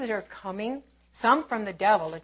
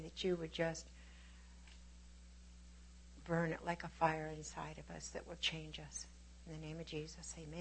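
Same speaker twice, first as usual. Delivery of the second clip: soft opening prayer; 3 semitones lower, 8 dB quieter than usual. Acted. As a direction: pray quietly and tenderly to the Lord. Opening prayer before the sermon.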